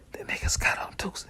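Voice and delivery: hushed secret whisper; whispered warning. Retold or imitated. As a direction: whisper the news. Telling Dre the secret.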